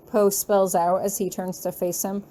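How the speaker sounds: ordinary delivery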